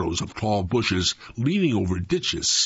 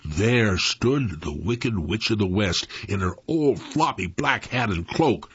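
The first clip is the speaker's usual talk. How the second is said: usual speaking voice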